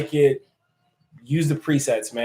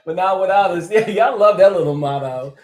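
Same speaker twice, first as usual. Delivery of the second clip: higher and louder, laughing along; amused, laughing while reading; 6 semitones higher, 5 dB louder than usual. Real, not acted. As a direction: chuckle through the motto. Laughs at the viewers' motto.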